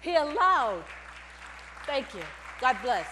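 ordinary delivery

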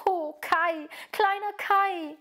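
high, drawn-out longing lament; sighing longing. A narrator's reading. Gerda pining for her lost little Kai, sighing. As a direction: sigh out the beloved name. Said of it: "seufzte Gerda".

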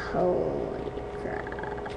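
very low, slow, faint croak; sunken deep voice. Real, not acted. Reacting after the last inhale, very deep and quiet. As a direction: let it sink far down and trail out.

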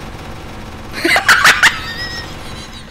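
long high-pitched cackle; laughing fit. Real, not acted. Gamer cracks up at the game, high wheezy laughing.

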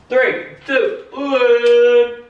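high, building countdown to the lights; excited countdown. Real, not acted. Counting down to switching on the lights, high and excited. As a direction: count down eagerly, rising to a loud one.

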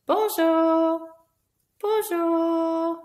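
bright puppet greeting; puppet bonjour. Acted. Puppets greeting each other in French. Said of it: "How might they say hello".

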